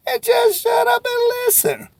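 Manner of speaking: exasperated coach yelling; comic shout. Real, not acted. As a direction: bark the advice like a coach. Punchline of sales advice.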